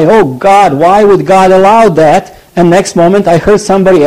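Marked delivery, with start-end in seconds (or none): 0.0-2.4 lightly voiced, higher, louder lament; mock anguished cry to God. A preacher imitates a hurt believer crying out, higher and louder. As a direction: play a self-pitying outcry, half-acted. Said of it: "I cry".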